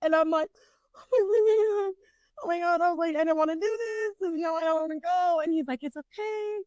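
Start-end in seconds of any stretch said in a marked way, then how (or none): 1.1-2.8 high-pitched whimpering panic, acted out; retold panic. Retold panic on stuck ride. 3.2-5.4 high, whiny rush of dread; acted-out panic. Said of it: "I'm trying not to cry". 5.8-6.6 soft, high soothing voice; gently placate, voice lifted and quiet; imitated reassuring friend. Friend reassuring her on ride.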